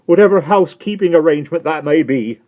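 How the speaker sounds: high, loud, mildly weepy; tearful Miss Pross. Acted. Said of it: "striving to dry her eyes".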